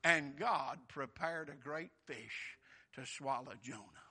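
slow, low, softened Bible quotation; scripture recitation. Real, not acted. Preacher quoting the great fish verse, hushed slow recitation. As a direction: let the verse land slowly and solemnly.

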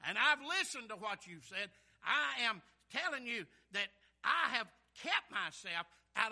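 loud, impassioned pulpit delivery; full-force preaching. Acted. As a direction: drive every phrase home to the congregation.

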